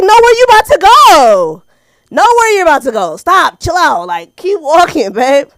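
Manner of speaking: high, loud calling to a dog; exasperated dog scolding. Retold or imitated. Calling to dog chasing bird poop.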